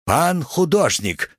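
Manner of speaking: high, drawn-out call without real shouting; sudden exclamation. A narrator's reading. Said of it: "вскричал вдруг Рабацкий". (внезапный высокий протяжный возглас)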